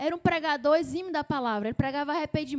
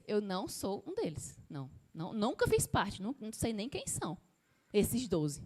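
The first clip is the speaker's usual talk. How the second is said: quiet denial; 6 semitones lower, 6 dB quieter than usual. Acted. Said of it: "Segunda vez".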